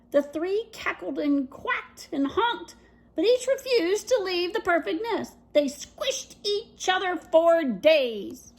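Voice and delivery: ordinary delivery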